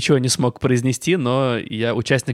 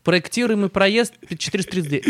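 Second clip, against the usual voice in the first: rattled off fast; 6 semitones higher, faster than usual. Pretend. Caller rattling address twice.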